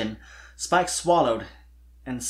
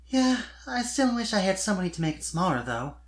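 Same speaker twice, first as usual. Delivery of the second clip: thoughtful; 6 semitones higher than usual. Acted. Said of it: "she said thoughtfully".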